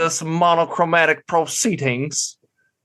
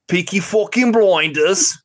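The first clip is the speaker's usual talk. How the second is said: put-on slow, higher Birmingham gangster accent; Peaky Blinders accent; 7 semitones higher, 3 dB louder, slower than usual. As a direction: drawl it like a Brummie gangster. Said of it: "the Peaky Blinders one was pretty bad".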